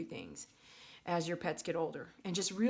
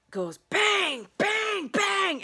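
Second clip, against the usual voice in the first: loud, high bang bang bang; mimicking door bangs; 13 semitones higher, 8 dB louder, much slower than usual. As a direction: slam each bang out loud. Imitating door slamming.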